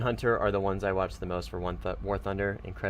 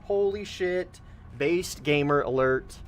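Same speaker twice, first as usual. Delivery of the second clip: excited hype; 5 semitones higher, slower than usual. Real, not acted. Hyping a viewer.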